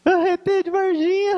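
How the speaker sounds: high, gleeful mock cry; mocking shout. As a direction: tease him like the laughing crowd. Congregation mocking the preacher. (grito zombeteiro e agudo)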